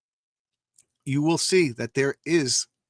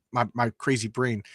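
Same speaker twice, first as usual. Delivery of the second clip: ordinary delivery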